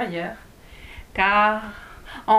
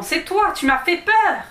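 high, louder exclamation after a fright; startled cry; 8 semitones higher, 5 dB louder than usual. A narrator's reading. Dwarf startled by Alice's arrival, startled cry. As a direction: cry out as if just made to jump. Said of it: "cria le nain en sursautant".

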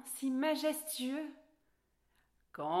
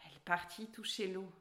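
usual speaking voice

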